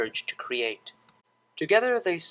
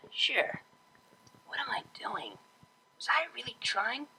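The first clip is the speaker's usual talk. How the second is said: unvoiced whisper, panicked aside; whispered to herself; 8 semitones higher, 8 dB quieter than usual. DJ questioning herself under her breath, whispered. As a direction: whisper your own doubts.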